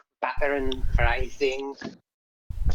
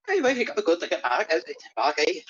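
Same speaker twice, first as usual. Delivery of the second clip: put-on Irish accent; 2 semitones higher, faster than usual. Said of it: "getting more Irish".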